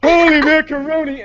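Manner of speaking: high, loud cartoon dad exclamation; Homer Simpson voice. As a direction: blurt it out like Homer. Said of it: "include the Homer Simpson thing".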